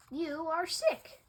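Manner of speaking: ordinary delivery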